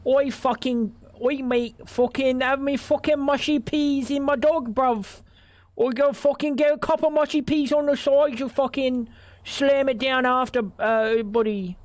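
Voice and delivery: high, hammed-up Australian accent. Mocking an Aussie ordering mushy peas, high-pitched Australian accent. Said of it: "the Australian accent".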